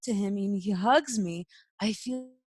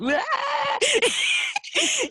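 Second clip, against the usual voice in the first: high, loud, open laugh; a real, helpless laugh; burst of laughter; 7 dB louder than usual. Real, not acted. Laughs at the memory.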